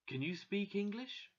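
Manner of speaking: put-on high, French-accented question. A narrator's reading. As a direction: ask politely with a French accent. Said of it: "he said, in his French accent".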